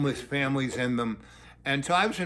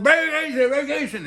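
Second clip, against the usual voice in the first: excited Scooby-Doo; 11 semitones higher, 6 dB louder than usual. Retold or imitated. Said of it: "he's talking like Scooby-Doo".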